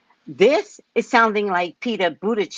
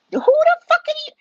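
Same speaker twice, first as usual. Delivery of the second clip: much higher, louder mock shriek; 6 dB louder, faster than usual. Imagined union official shrieking back, much higher. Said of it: "The union said".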